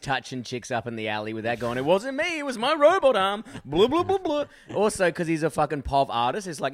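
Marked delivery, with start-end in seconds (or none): 1.8-3.4 high-pitched, loud comic protest; mock excuse. Comic mimics a busker blaming his robot arm, mock excuse. 3.6-4.4 high, loud silly blubbering noise; robot arm babble. Acted. Comic mimics a busker's robot arm, silly babble noise.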